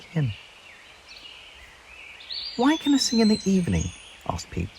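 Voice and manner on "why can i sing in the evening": small bird voice. Acted. Peep voiced high.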